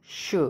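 sh hissed soft and long; demonstrated sh. Shown as a demonstration. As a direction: give a soft, drawn-out sh. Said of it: "Again, S C H sound is".

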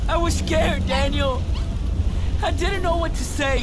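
high, loud, pleading explanation; desperate confession. Acted. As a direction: plead to be understood over the uproar. Brother confesses over the uproar.

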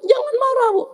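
very high, held note; high-pitched admonishing. Acted. Child telling mother not to be angry, high-pitched.

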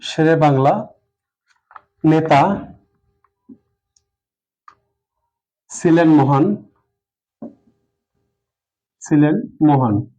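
jumbled sentence read word by word with long gaps; slow dictation. Shown as a demonstration. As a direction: dictate the scrambled order slowly. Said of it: "উল্টাপাল্টা লিখি যে শেরে বাংলা". (উল্টো বাক্য ধীরে ধীরে বলা)

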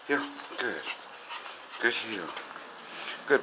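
soft soothing commands; quiet calm praise. Real, not acted. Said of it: "how to calm him".

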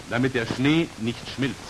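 ordinary talk

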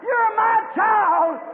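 slow, loud, high shouted call; mother eagle's cry. Acted. Mother eagle shouts to her son among chickens.

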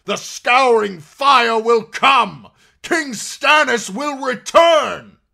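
high, drawn-out prophetic scream; continued shouting. A narrator's reading. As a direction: shout the prophecy at full force. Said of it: "he continued to shout".

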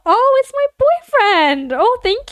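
high, drawn-out fangirl squeal; gushing. Real, not acted. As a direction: squeal slowly over your crush. Gushing over a crush's picture.

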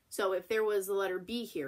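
ordinary delivery

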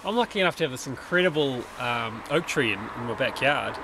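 normal talking voice

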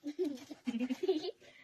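big burst of laughter; loud laugh. Real, not acted.

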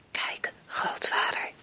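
slow, hushed whisper; soft voiceless whisper. A narrator's reading. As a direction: whisper it slowly in awe. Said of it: "fluisterde Joris".

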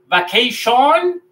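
"vacation" as "ba-kei-SHON", last O full and stretched; wrong Spanish-style pronunciation. Shown as a demonstration. Teacher tests learners with the mistake, mispronounced. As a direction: end "vacation" on a rising, heavy "shon". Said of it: "No, no vamos a decir vacation".

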